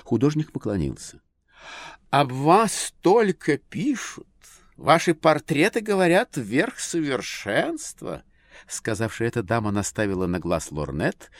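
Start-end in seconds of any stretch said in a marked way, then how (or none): 2.1-8.2 high, slow, admiring lady; society lady voice. A narrator's reading. Lady's lines in story.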